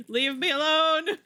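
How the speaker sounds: shrill terrified yelling; high panicked shout. Real, not acted. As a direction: scream at the monsters. Spiders attacking in game.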